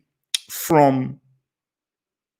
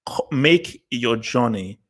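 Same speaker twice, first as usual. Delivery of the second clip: normal talking voice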